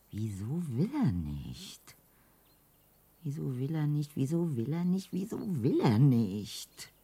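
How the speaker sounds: growing exasperation. Acted. Repeating in growing disbelief.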